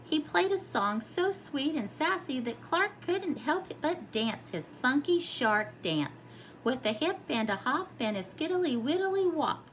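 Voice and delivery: plain reading voice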